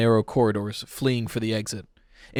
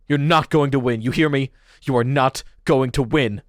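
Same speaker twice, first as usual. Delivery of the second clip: defiant shout; 3 semitones higher, 6 dB louder than usual. Acted. Pete defies the fox.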